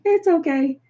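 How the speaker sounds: high, loud mock-breezy brush-off. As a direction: toss it away like nothing's wrong. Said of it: "But I'm like".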